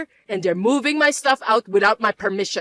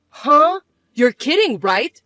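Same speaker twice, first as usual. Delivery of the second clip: shocked disbelief; 3 semitones higher, 5 dB louder, slower than usual. Acted. Mother shocked by news.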